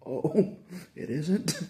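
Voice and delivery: high, loud mock-surprised oh, laughing; mock surprise, laughing. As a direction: play dumb with a laughing gasp. Feigned surprise at the bishop.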